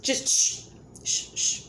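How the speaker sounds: hissed shh to silence commenters; shushing doubting commenters, whispered and sharp. Real, not acted. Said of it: "Shut up".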